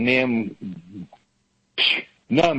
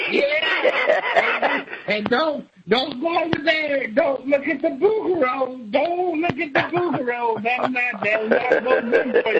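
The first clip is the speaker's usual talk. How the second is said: much higher, silly warning voice; mock baby talk; 10 semitones higher than usual. Mocking fussy parent warning about the boogeroo. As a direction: warn a toddler in a squeaky voice.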